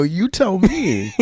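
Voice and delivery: wry retort. Retold or imitated. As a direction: toss it back at her, slowly. Speaker's retort to clerk.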